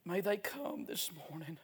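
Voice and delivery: pleading prayer. Real, not acted. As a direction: beg God earnestly, with long pauses. Prays aloud for the unsaved.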